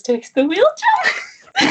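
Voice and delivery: laughing through the line; giggling, high at the end. Real, not acted. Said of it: "wanna make a joke".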